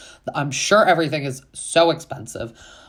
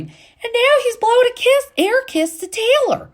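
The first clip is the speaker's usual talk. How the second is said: much higher, louder, in squealing disbelief; shocked disbelief; 6 dB louder than usual. Real, not acted. Recapping the episode to viewers, real shocked disbelief. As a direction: shriek it like you can't believe your eyes. Incredulous at cast member's kiss.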